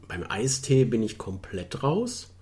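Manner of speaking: ordinary talk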